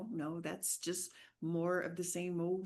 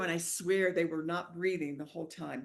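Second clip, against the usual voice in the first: ordinary delivery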